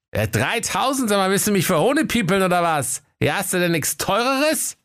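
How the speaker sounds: high, indignant comic voice; affronted rich customer. Acted. Kaiser wants something dearer, high and indignant. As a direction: protest as if insulted by cheapness.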